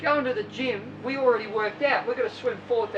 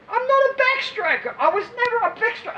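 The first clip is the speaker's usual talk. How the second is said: much higher, fast indignant swimmer; whiny protest; 11 semitones higher, 4 dB louder than usual. Retold or imitated. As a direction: protest like a stubborn teenager.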